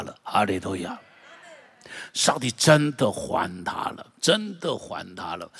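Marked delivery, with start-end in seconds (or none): 2.1-5.5 slow emphasis. Acted.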